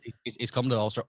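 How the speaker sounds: ordinary delivery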